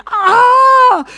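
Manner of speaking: high, loud, drawn-out acted scream; retold scream. Man shocked awake from his dream, acted scream. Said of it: "he screamed".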